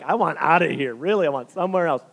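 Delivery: emphatic comic protest; mock pleading. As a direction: play up being desperate to move. Acts out wanting to move house.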